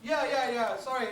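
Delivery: ordinary talk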